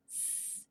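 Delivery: a soft hissing "S" on its own; S sound. Shown as a demonstration. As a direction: sound out "S" as a long hiss. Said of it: "There's an S sound".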